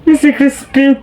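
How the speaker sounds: loud, anguished son pleading; anguished Basilio. Acted. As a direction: plead with your mother at full voice. Basilio recounts beating, begs mother. (malakas at nagdadalamhating pagsusumamo)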